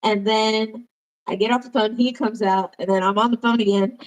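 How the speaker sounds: normal talking voice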